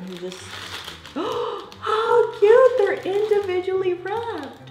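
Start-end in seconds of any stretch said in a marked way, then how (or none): 1.1-4.7 delighted squeal. Real, not acted. Gasps at tiny moon pies.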